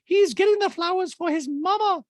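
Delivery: very high, forceful caricature; Dorit impression. Dorit defending Jade to Erica, squeaky high voice. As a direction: push it up into a piercing put-on voice. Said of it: "And Dreed's like".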